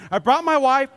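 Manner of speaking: ordinary delivery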